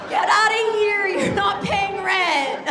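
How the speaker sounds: high, loud shouted eviction, slowing on the end; mock shout. Comic yelling at the crowd to get out, loud and high. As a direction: yell it like a landlord throwing someone out.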